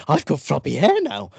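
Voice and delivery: high, silly mocking voice; mocking impression. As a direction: ham up a smug comedian. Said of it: "just start nodding your head and going like".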